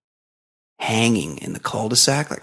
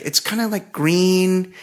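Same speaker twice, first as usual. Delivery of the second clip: much higher, unsure lady voice; vague high woman; 7 semitones higher, slower than usual. Retold or imitated. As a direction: let it drift upward unsure. Woman describing snake color.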